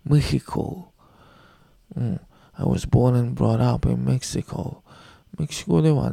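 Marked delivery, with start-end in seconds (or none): 0.1-0.9 "メヒコ" with a Spanish j, a breathy h sound; スペイン語読みで「メヒコ」; Spanish reading. Shown as a demonstration. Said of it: "スペイン語読みすると".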